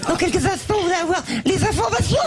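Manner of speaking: very high laughing TV announcer; Denise Fabre. Retold or imitated. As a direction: giggle through the announcement. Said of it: "Denise Fabre".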